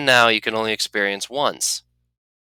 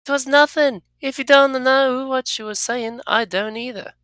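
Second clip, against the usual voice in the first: higher, defensive character voice; companion Anna voice; 4 dB louder than usual. Acted. Anna defending herself to the player, higher and guarded. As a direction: deny it, a bit on guard, pitched up.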